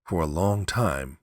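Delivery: plain reading voice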